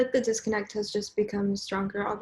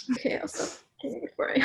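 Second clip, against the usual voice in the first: embarrassed whisper, trailing off; 4 semitones higher, 4 dB quieter than usual. Real, not acted. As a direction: whisper it, about to laugh.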